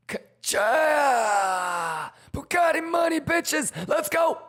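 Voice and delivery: high, loud victory yelling; hype shouting. Real, not acted. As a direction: celebrate the win loudly. Trade win celebration.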